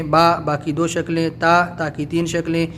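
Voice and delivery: ordinary delivery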